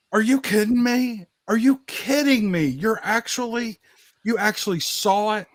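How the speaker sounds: retold disbelief, voice jumping up; incredulous. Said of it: "And I was like".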